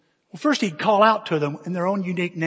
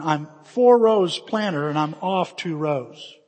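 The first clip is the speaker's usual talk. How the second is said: normal talking voice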